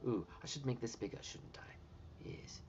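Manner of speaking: hushed, whispered muttering; soft whisper, thinking aloud; whispering to self. Real, not acted. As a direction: whisper approval to yourself under your breath. Muttering to self while editing.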